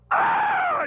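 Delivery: sudden very high, loud shriek of panic; terrified scream. Retold or imitated. Boy threatened by the angry dad, a high loud shriek. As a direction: shriek as if the dad is coming for you.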